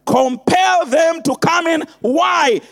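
full-force preaching. Acted. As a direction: hammer each line home. Building sermon refrain, shouting.

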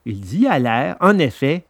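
ordinary delivery

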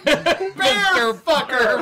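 slow words through helpless laughter; laughing hysterically. Real, not acted. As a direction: crack up while saying it.

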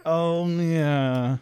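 normal talking voice

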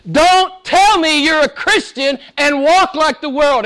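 high, loud, slow pleading cry; John's exclamation, high and slow; voicing John's exclamation. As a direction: cry out as the apostle John. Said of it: "It's like First John says".

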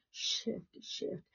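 hushed, voiceless whisper; barely audible, breath-only repeat. Real, not acted. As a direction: drop right down and whisper each "shift". Same word repeated in a hush.

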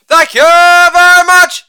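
high, loud, sustained catchphrase; showman's ringing thank-you; showy sign-off. Acted. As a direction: belt it out on one held high note.

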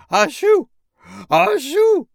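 two huge high sneezes; snowman's loud sneezes. Acted. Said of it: "sneezed the snowman".

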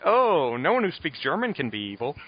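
high, relieved mock exclamation; gullible board. Parole board swallowing the lie, mock relieved. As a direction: fall for it gushingly. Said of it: "And they're like".

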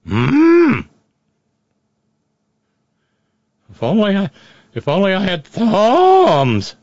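high, slow dopey cow; wistful cow wishing aloud. Acted. Voicing a cow wishing for thumbs.

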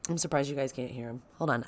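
ordinary delivery

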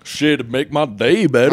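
loud, hammed-up character voice; riled-up Joey. Joey taunting his pal in the bit, hammed-up and riled. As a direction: goad the pal with mock indignation.

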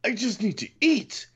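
high, whiny mock complaint; mock whiny hunger. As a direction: squeak out a hungry whine. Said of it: "I was like".

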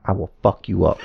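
ordinary talk

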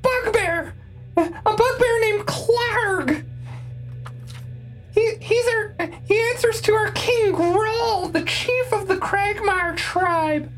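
high, cackling goblin blurting; bloodthirsty cackling goblin. Acted. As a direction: shriek it out like a frantic goblin. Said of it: "Great goblin voice".

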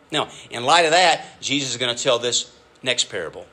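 ordinary talk, nothing marked

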